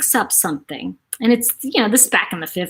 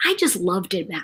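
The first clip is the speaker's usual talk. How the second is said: usual speaking voice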